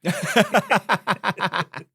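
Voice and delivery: a burst of high laughter; laughing. Real, not acted. Laughs at RAF lavatory joke.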